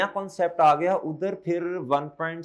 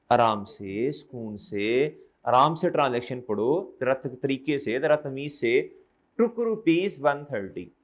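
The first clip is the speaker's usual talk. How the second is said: lower, calm and measured demonstration; slow, unhurried reading; 3 semitones lower, slower than usual. Shown as a demonstration. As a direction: read it out slowly, at ease. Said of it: "slow slow पढ़ना शुरू करें".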